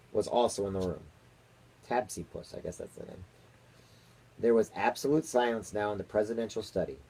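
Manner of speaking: plain reading voice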